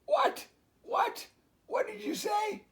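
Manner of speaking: very high, shouted confused quack; quacking duck. Acted. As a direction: squawk it like a frantic duck. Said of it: "Duck quacked".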